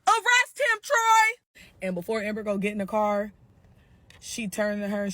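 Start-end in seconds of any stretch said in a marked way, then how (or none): 0.1-1.6 very high, loud shriek of a name; shrieking yell. Retold or imitated. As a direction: screech for the police. Sarah yelling to cop.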